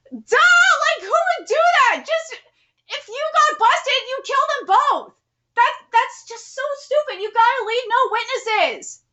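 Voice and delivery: high, loud, scornful ranting; a real tirade, pitched high and loud; exasperated rant. Real, not acted. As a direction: rail at the idiots on the page. Reader rants at the characters.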